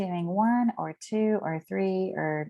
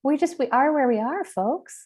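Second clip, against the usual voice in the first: exasperated retort; 8 semitones higher, 4 dB louder than usual. Retold or imitated. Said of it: "I'm like".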